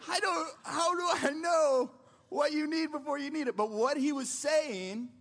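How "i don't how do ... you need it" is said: put-on whiny kid protest. Ten-year-old son protests to his demanding father, crying out. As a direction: whine back like an exasperated child. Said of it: "And I'm like".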